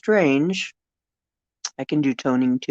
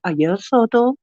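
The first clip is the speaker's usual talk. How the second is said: high, lilting star language; short invented phrases, each lifted in pitch; speaking in tongues; 6 semitones higher, 4 dB louder than usual. Shown as a demonstration. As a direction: intone strange syllables like an unknown tongue. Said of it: "That's what mine usually sounds like".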